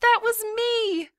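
put-on high, loud, drawn-out kid's boast; gleeful child showing off; excited girl. A narrator's reading. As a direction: squeal it proudly, slow and high. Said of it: "She did a cartwheel out of the den".

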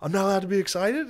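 very high whoop into quick high protest; fast, squealed mock indignation; Philip Rivers excited. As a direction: imitate a giddy kid objecting mid-celebration. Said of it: "he switches from being like".

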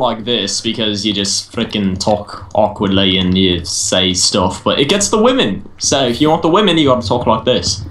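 low put-on Aussie accent; Australian accent. Shown as a demonstration. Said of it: "Aussie accent is more like this".

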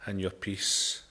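soft, low, hushed prayer. Acted. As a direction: pray it quietly and reverently. Closing prayer to the congregation.

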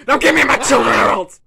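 loud menacing demand; drug-lord frog demanding his due; angry threat. Acted. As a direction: bark it out as a threat. Kermit threatens.

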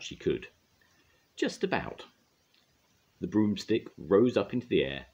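plain reading voice

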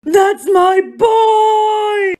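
long drawn-out wailing shout; anguished shout. Acted. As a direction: howl it out slowly. Father shouting over dead son.

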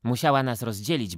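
ordinary delivery, nothing marked

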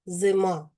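"зима" with the и leaning towards е; "зима", first vowel reduced towards е; unstressed и towards е. Shown as a demonstration. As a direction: say "зима" close to "зема", stress on the а. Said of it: "вимовляємо з наближенням до е".

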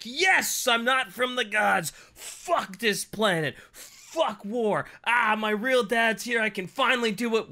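high, loud elated cheering; giddy high-pitched excitement; overjoyed. Retold or imitated. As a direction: burst out with relief and joy. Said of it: "He's like".

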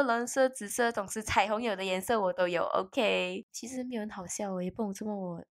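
ordinary talk, nothing marked

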